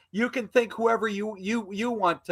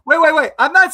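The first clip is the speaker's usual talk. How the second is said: loud, higher, cutting in hard; urgent interruption; 6 semitones higher, 10 dB louder than usual. Real, not acted. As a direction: stop everyone with a loud wait. Cutting in during the argument.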